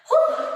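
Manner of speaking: a high, loud blown-out whew; relieved whew. Real, not acted. Coach right after the crunch hold, a loud relieved whew. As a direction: blow out the effort in one breath.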